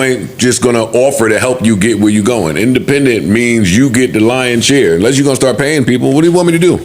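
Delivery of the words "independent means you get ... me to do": usual speaking voice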